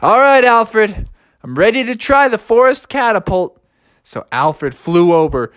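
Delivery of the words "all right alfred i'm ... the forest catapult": mildly weary, higher character voice; reluctant agreement; tired giving-in. A narrator's reading. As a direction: give in with a touch of tiredness. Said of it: "so tired".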